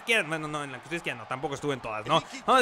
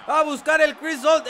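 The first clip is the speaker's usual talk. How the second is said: excited; 11 semitones higher, 7 dB louder, slower than usual. Acted.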